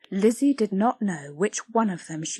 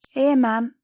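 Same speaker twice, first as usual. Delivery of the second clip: put-on small child's answer, higher; young Lizzie; 5 semitones higher, 5 dB louder than usual. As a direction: answer meekly.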